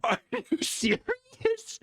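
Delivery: very high, slow disbelief; high drawn-out are you serious; incredulous squeal. Real, not acted. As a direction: stretch it out in shock. Stunned at the chess position.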